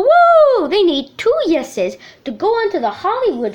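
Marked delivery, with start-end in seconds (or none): 0.0-0.7 high cheering woo. Pretend. Host cheers after the title.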